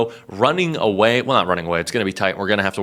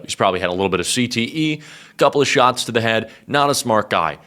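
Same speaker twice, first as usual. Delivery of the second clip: usual speaking voice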